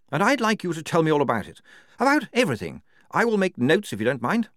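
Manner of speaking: brisk official character voice; Major Clogston, brisk officer. A narrator's reading.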